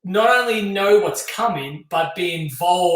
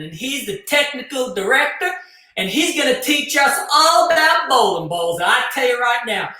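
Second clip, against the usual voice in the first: Southern American accent; 7 semitones higher than usual. Shown as a demonstration. Host welcoming viewers on a dare, country Southern American accent. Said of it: "So you want Southern, Southern American".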